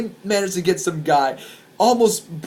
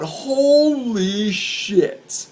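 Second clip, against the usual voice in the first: very drawn-out, high-pitched awe; awestruck exclamation; 5 semitones higher, much slower than usual. Retold or imitated. Watching a man swung like a club, awestruck. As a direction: stretch it out in retold amazement. His reaction to mosh pit chaos.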